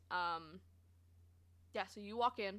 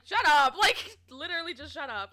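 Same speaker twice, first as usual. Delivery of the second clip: laughing exasperation; 4 semitones higher, 9 dB louder than usual. Real, not acted. Exasperated rant about the customer.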